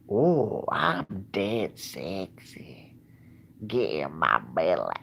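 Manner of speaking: slow, drawn-out comic Scottish brogue; hammed-up Mike Myers Scottish character; Fat Bastard. Vlogger quoting film catchphrases, hammed-up Scottish accent. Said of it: "or Fat Bastards. Basically, Mike Myers Scottish".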